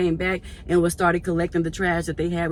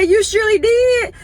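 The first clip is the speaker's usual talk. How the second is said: high, loud and teasing; hammed-up playful retort; playful loud reply; 8 dB louder than usual. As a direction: tease her right back. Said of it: "I said".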